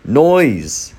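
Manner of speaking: contained mock shout; yelled. A narrator's reading. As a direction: yell it as a narrator would. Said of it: "mostly he just yelled".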